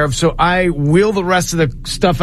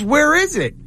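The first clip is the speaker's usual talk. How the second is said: imitating Bill; 10 semitones higher than usual. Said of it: "He goes".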